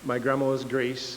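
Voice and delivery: usual speaking voice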